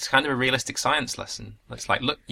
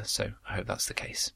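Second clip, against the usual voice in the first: soft unvoiced aside; whispering; 10 dB quieter than usual. Real, not acted. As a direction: whisper it to yourself. Aside after costly mission.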